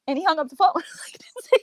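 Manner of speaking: high, breathless laughter through the words; laughing while telling. Real, not acted. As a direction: crack up helplessly as you tell it. Laughs recalling dad hanging up on her.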